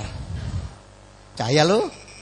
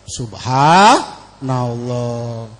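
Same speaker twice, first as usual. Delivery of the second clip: awed subhanallah; 8 semitones lower, much slower than usual. Retold or imitated. Awed exclamation, slow.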